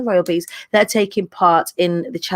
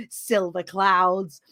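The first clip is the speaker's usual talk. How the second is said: mock dreamy; 3 semitones lower, 4 dB quieter, much slower than usual. Real, not acted. Repeats viewer's phrase mockingly.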